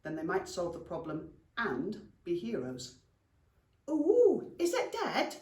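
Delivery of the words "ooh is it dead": high, curious little goblin; little goblin's voice. Acted. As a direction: pipe up with a high, wondering "ooh". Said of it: "came a voice from behind them".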